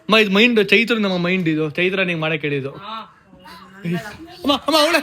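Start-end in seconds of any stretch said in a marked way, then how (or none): 4.4-5.0 high, loud protest through laughter; squealed, giggling excuse; laughing. Real, not acted. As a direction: shift the blame while cracking up. Laughing, shifting blame to accomplice.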